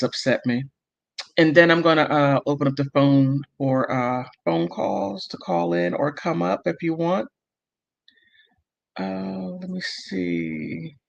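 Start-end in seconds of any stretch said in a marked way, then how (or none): none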